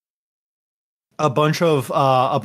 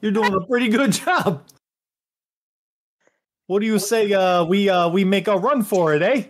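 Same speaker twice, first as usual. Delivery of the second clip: higher, quick Canadian buddy voice with a friendly "bud"; Canadian-ish accent; 7 semitones higher, faster than usual. Acted. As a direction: play a chummy Canadian hoser, a bit higher and fast. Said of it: "I can only imagine Ricky with that accent".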